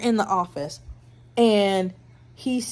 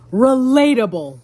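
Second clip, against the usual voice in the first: emphatic; 5 semitones higher, 11 dB louder than usual. Real, not acted. Loud reaction to the quote.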